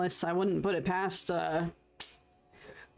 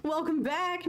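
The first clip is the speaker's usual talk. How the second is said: normal talking voice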